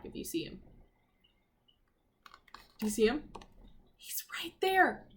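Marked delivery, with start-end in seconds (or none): 4.0-5.0 whisper. Acted. Teacher whispering to the class where Jack is. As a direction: breathe it out like a secret.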